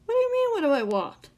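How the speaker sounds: high, loud mocking echo. A narrator's reading. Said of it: "in a mocking tone".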